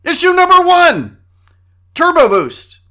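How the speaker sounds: big booming announcer. Pretend. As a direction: call it out like a show announcer. Dramatic announcer segment title.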